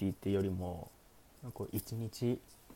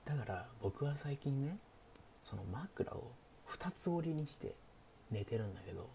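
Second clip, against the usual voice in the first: hushed late-night chat, often whispered; sleepy; 4 dB quieter than usual. Pretend. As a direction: talk softly like the listener is drifting off. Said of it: "寝落ち配信なんでね". (小声でささやく寝落ち配信トーク)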